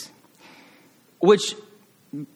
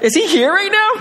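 bright, high, eager question; excited wondering; 6 dB louder than usual. Retold or imitated. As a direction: ask it with sudden excitement, much higher. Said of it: "I'm like".

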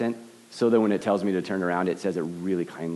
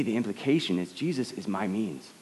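ordinary delivery

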